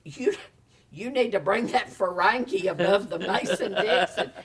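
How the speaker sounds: loud, high imitation of a director; hammed-up outburst, drawing out the punchline; exasperated director. Director telling a Southern Ferengi off, loud mock exasperation. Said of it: "and the director says".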